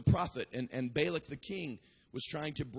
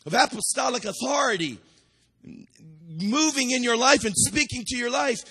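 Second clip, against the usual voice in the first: full-force preaching; 5 semitones higher, 8 dB louder than usual. Acted. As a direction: preach it out hard to the crowd. Fiery sermon at full force.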